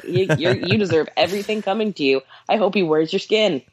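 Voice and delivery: normal talking voice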